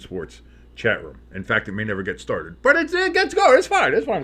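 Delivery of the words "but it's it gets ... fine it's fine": very high, tight squeak, fading out; squeaky high voice. Pretend. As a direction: insist it's fine in a strangled squeak. Chat room never gets started.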